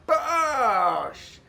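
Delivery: high, drawn-out bosh that falls away; shot call. Real, not acted. Commentator calling a replayed shot, drawn-out exclamation. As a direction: stretch the word out as the shot hits.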